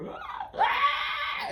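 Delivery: high-pitched monster shriek; creature cry. Acted. Game master voices the thing running through the field, creature cry. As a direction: screech like a charging creature. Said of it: "And you hear".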